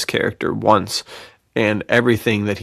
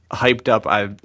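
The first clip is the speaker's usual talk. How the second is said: normal talking voice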